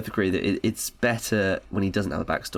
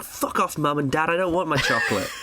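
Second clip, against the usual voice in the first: higher, defiant mock brat; bratty teen; 6 semitones higher than usual. Imagining Noodle refusing her parents, higher bratty voice. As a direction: snap back like a sulky teenager. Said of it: "She's like".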